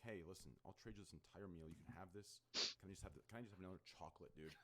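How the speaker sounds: low, soft begging; quiet pleading. Retold or imitated. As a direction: ask softly for chocolate. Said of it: "I'm like".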